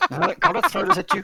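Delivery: high, fast playful yell; laughing shout. Real, not acted. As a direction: yell a mock threat. Playful fight over logs.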